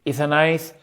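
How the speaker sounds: Castilian accent with a lisp; Spanish accent. Shown as a demonstration. Claiming to be from Zaragoza, Spanish accent. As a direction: play a Spaniard groping for English. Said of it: "when I'm doing this one".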